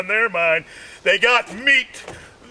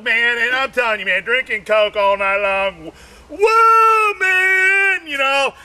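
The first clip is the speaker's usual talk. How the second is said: ordinary talk, nothing marked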